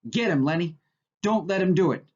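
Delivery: urgent yell, rising toward the end. A narrator's reading. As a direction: shout him on from across the room.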